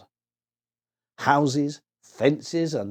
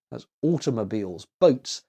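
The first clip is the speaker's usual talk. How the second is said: ordinary delivery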